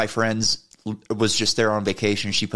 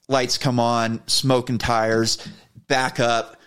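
plain reading voice

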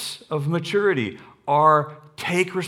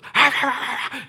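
dog growl imitation; 8 semitones higher than usual.

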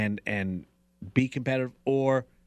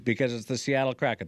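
usual speaking voice

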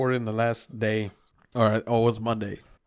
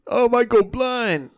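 much higher, louder, slower mock panic; mock Karen; 11 semitones higher, 6 dB louder, slower than usual. Mocking his friend's brake fluid panic, higher and louder. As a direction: ham up a fretful Karen. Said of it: "you like one of the millennial Karens".

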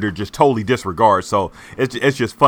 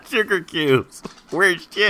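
slow, higher mock cop voice; tough detective; 10 semitones higher than usual. Co-host quoting the detective, mock noir cop. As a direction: drawl it like a sleazy detective. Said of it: "that's exactly how he sounds".

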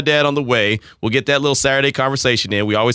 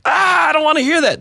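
annoyed shout. Retold or imitated. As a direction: yell like nails on a chalkboard. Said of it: "and you're like".

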